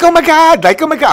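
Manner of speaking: high, loud over-the-top squeal of delight; mocking a friend's loud high squeal; gushing squeal. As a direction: shriek it like a starstruck fan. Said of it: "when you came on with".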